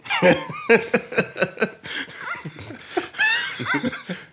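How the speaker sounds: wild high shrieking cackle; maniacal laugh. Real, not acted. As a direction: cackle like an unhinged villain. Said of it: "Why, why did you maniacally laugh?".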